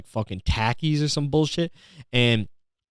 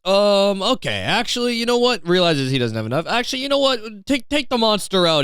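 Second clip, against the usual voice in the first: higher, lightly voiced sheepish customer; imitating the guy; 7 semitones higher than usual. Mimicking the face-tattoo man at checkout, lightly voiced and higher. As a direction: play the broke guy backing down. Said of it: "he's just like".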